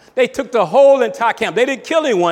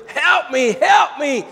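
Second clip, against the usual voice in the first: mock cry for help, pitched higher; imitated crying out; 5 semitones higher, slower than usual. Preacher voicing soldiers crying for help, raised imitated cry. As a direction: cry out like the men, without full volume. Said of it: "crying out to they".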